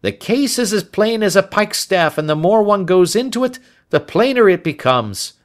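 high, loud inspector voice; emphatic, self-important police inspector; cocksure Lestrade. Acted. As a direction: insist the case is obvious.

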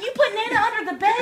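high, loud disbelieving question; incredulous. Retold or imitated. Daughter confronting mom about Nana, incredulous. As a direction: re-enact being appalled at mom.

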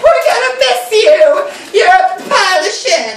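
very high-pitched, screechy farewell; mock shrieking sister. Missus Joe seeing Pip off, mock high screech. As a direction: squawk it in a hammed-up high voice.

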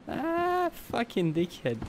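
high drawn-out groan falling into a slow curse; exasperated, pitch starting high; exasperated cursing. Real, not acted. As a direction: draw out the cry, then curse slowly. Reacting to someone blowing up.

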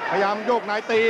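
ordinary talk, nothing marked

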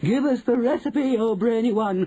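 high, loud pleading chorus; impatient cry. A narrator's reading. Witches begging for the recipe, impatient cry. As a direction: clamour for it at high pitch. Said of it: "cried the audience impatiently".

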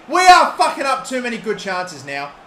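real annoyed outburst; frustrated rant. Real, not acted. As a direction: vent at the team through the screen. Ranting after the miss.

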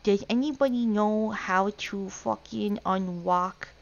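put-on baby-talk Rs and Ls turned to W. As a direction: put on toddler speech, R and L as W. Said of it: "Because it's a redeem".

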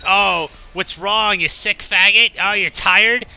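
loud, high-pitched mocking jeer; taunting bully. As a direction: play a bully goading his victim. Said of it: "comes to me like".

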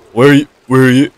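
loud call to a friend. Real, not acted. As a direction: shout to find them in the dark. Calling out to partner in dark.

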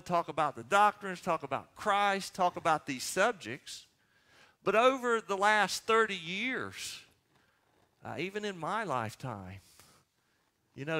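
ordinary talk

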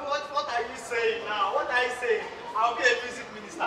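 ordinary delivery

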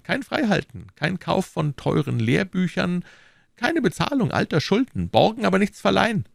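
höher gesprochener, drängender McFarland; überredet den Freund mit Nachdruck; McFarland, pushing hard. Acted. McFarland voiced. (McFarland drängt Fettes, höhere eindringliche Stimme)

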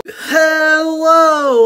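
loud, high excited hello; big hello. Real, not acted. Vlogger greeting her viewers with a loud high hello. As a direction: throw a huge greeting to viewers. Vlog intro greeting shouted.

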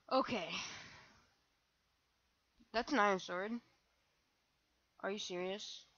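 normal talking voice

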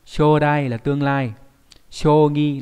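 deliberate classroom model reading; slow careful reading. Shown as a demonstration. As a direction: spell out shodai and shogi for learners. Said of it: "đọc là".

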